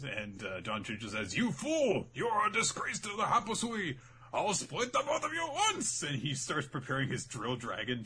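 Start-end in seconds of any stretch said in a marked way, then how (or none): 1.4-6.0 high, hammed-up raging elder; furious grandpa, hammed-up and high; mock furious old man. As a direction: bellow threats at a traitor.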